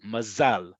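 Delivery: "mazal" as ma-ZAL; last-syllable stress. Shown as a demonstration. Teacher shows the emphasis in "mazal", stressed ma-ZAL. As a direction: put the weight on the "-zal" of "mazal". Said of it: "emphasis is on the last syllable".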